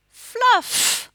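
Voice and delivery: Fluff said high and loud, stretching the f; stressed fluff. Shown as a demonstration. Teacher stresses the last sound of Fluff for pupils. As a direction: lean into the final f of Fluff. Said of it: "What's the last sound?".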